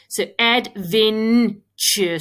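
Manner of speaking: adventure said slowly, each syllable apart; sounding out words. Shown as a demonstration. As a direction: stretch adventure into ad-ven-ture. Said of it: "When I say it sl-, slowly and break it into syllables".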